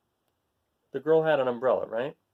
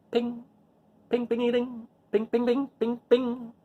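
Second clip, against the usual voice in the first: tin roof pings; 7 semitones higher, slower than usual. A narrator's reading.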